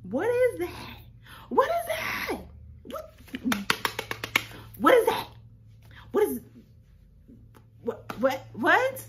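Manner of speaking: high, loud shrieks of "what"; shrieking disbelief. Real, not acted. Seeing the baby post, shrieking high and loud. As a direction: scream it out, stunned.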